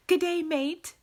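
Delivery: high, loud attempt at Australian; bad Australian accent. Shown as a demonstration. Streamer greeting an Australian viewer, bad Australian accent. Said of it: "really bad at my Australian accent".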